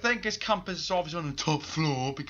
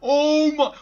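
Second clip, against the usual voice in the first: startled scream; 12 semitones higher, 16 dB louder than usual. Real, not acted. Jump scare in horror game.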